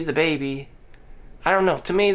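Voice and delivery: normal talking voice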